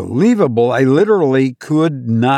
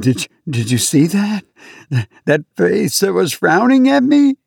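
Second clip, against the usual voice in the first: alarmed plea; 7 semitones higher than usual. Acted. Panicked patient pleading with attendant.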